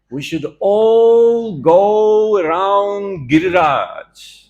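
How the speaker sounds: slow, high, loud call to the crowd; drawn-out call. Acted. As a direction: stretch every word out like summoning a village. Krishna calls everyone round Govardhan.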